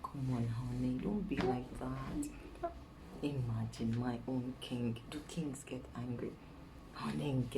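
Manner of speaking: low, soft, acted sweet talk; coaxing. Wife placating her angry king, coaxing. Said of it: "If I see that he's angry".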